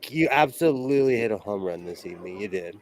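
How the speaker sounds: usual speaking voice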